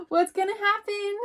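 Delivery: a little mouse calling out, startled. Acted. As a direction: call out as if someone just knocked. Teacher reads picture book aloud.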